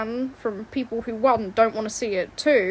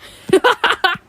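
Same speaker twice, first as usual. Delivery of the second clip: high squealing laugh; 8 semitones higher, 10 dB louder than usual. Real, not acted.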